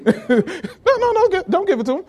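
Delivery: high, fast, laughing no; laughing protest. Real, not acted. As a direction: stop him mid-laugh. Laughing at a volunteer's mistake.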